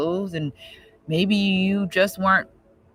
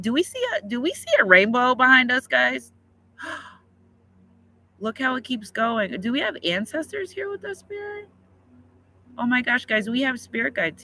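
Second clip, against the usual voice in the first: higher, amazed, calling to the viewers; excited awe; 6 semitones higher than usual. Real, not acted. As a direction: gasp at a rainbow and call out to the viewers. Sees rainbow on livestream.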